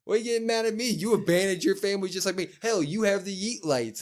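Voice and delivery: high-pitched, rushed retort; indignant mock protest. Retelling Gable's protest to Jay.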